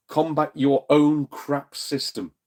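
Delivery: slow emphatic jab. Real, not acted. Angry slow closing line.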